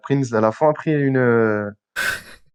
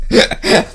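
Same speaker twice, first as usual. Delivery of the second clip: burst of laughter; 9 semitones higher, 4 dB louder than usual. Real, not acted. Reacting to the far-fetched therapist.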